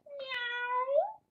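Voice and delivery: high, drawn-out kitty meow; cat meow. Pretend. Voicing the book's cat for a toddler, high playful meow. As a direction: mew like a little cat.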